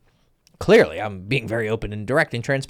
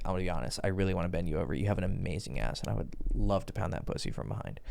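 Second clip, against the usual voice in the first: deadpan, devilish; 5 semitones lower, 9 dB quieter, faster than usual. Shown as a demonstration. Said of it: "deadpan or kinda devilicious".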